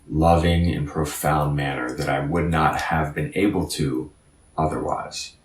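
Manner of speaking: ordinary talk, nothing marked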